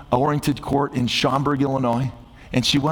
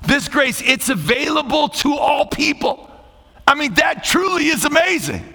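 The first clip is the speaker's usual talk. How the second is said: high-pitched sermon delivery, building higher; impassioned preaching; 8 semitones higher, 3 dB louder than usual. Real, not acted. Pastor telling the congregation grace is for all, impassioned preaching. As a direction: preach it out, climbing in pitch with each point.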